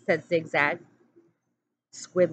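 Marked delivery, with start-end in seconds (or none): none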